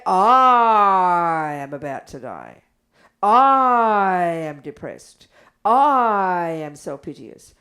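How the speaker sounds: a hammed-up, sluggish self-pitying lament; mock self-pity. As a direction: ham up a sorry-for-yourself wail. Mocking self-pitying inner voice.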